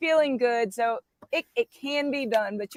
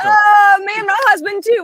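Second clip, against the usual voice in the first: excited cry; 8 semitones higher, 11 dB louder than usual. Real, not acted. Excited reaction to shared story.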